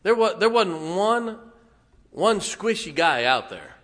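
loud, high preaching about tough men; rising pulpit shout; fiery preaching. Real, not acted. Preaching at full force.

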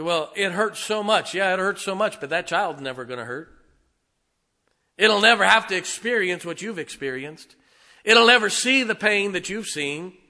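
loud, rising preacher's cry; preaching at full force. Acted. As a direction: build it and lift the congregation.

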